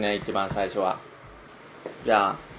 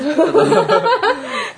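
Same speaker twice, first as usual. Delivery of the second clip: loud high burst of laughter; big laugh; 7 dB louder than usual. Real, not acted. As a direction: laugh out hard. Laughing at own joke.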